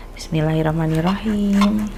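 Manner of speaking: usual speaking voice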